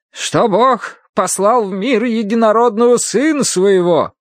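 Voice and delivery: high, slow scripture declamation; stern Bible-reading woman. Acted. Woman with a Bible over a patient, menacing high intoning. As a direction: intone the curse in a high, drawn-out voice. Mrs. Gadson reads scripture.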